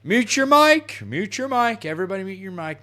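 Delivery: loud, high mock order that fades off; mock bossy yell fading. Lobby leader ordering everyone to mute, mock yell fading. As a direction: start yelling then trail off.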